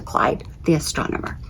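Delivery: hushed unvoiced finish; whisper. A narrator's reading. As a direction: let the name fall to a whisper. Reading author's note.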